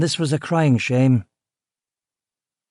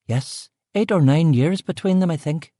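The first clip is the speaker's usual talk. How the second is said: put-on lightly higher Belfast accent; trailing-off Belfast cook; 5 semitones higher than usual. Cook answering about the brothers, in a Belfast accent.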